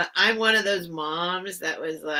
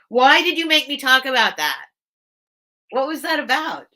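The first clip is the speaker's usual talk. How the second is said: raised, louder mock accusation; high, loud wail of protest; playful tearful complaint; 6 semitones higher, 6 dB louder than usual. Real, not acted. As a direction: blame them jokingly through tears. Mock blaming while tearing up.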